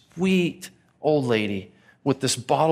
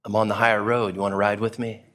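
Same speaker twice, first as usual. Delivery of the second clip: low, self-satisfied bragging; smug boast; 6 semitones lower than usual. Retold or imitated. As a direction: play the holier-than-thou, pleased with yourself. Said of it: "I'd love to be able to say".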